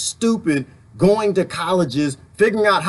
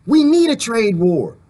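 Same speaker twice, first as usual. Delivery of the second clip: high, eager yes to a trade war; excited mock cheer; 9 semitones higher, slower than usual. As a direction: cheer it on like you want the fight. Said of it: "And I'm like".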